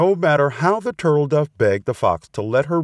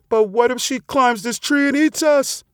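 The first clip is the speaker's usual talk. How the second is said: high-pitched anxious question; worried bird. A narrator's reading. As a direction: ask in alarm as a storybook bird. Threatened bird voices her fear.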